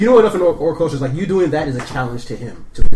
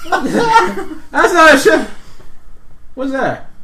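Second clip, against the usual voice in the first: confused orc; 14 semitones higher, 6 dB louder, much slower than usual. Acted. Orc guard confused, laughing.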